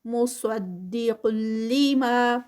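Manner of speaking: مصدق لما drawn out, heavy صاد, doubled لام; slow recitation demo. Shown as a demonstration. Teacher reciting the verse for a student. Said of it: "صاد جو ہے وہ heavy ہے".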